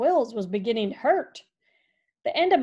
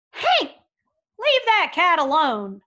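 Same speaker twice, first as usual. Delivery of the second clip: much higher, louder yell across the yard; shouted command; 6 semitones higher, 7 dB louder than usual. Acted. Rancher yelling at his dog from the porch. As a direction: call the dog off sharply.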